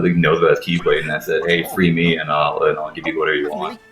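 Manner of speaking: ordinary delivery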